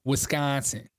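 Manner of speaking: Wisconsin in a Midwest accent. Shown as a demonstration. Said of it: "saying it in a Midwest accent".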